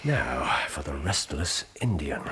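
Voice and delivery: low, hushed deduction; low murmur. Real, not acted. Holmes murmurs his deductions to Watson, low and hushed. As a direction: keep it low and private between you two.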